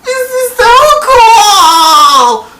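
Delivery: long, high, loud squeal; mock-thrilled fangirl shriek; imitated gushing excitement. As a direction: squeal it out, stretching every word. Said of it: "she's just there and she's like".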